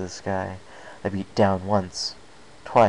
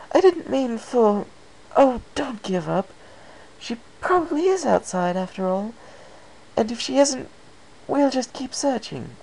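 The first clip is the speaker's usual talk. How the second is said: much higher refined lady, soothing and urgent; Rarity, comforting; 4 dB louder than usual. Acted. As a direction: elegant mare rushing to reassure a friend. Rarity comforts despairing Chaser.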